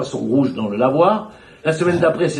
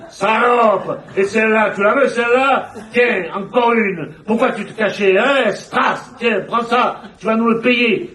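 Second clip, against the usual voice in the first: a hint of thugs beating her, somewhat higher; brutal men's voices; 7 semitones higher, slower than usual. Imagined men hitting her, lightly voiced jeering menace. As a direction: jeer and strike, lightly voiced. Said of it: "les voix d'hommes".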